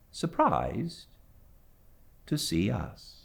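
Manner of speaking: measured, unhurried reading of a classic novel; story narration. A narrator's reading. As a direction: read it like a storyteller at the fireside. Reads novel chapter aloud.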